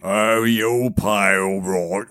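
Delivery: low, slow thug's threat; menacing giant. A narrator's reading. Giant threatens friar who cannot pay, menacing giant.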